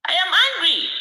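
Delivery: real anger. Real, not acted. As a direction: let the anger burst out on angry.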